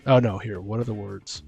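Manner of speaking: ordinary talk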